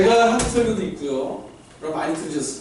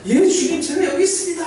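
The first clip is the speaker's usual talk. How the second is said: answering the call; 10 semitones higher than usual. Acted. Moses answers God's call.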